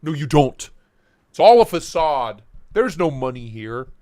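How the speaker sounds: ordinary talk, nothing marked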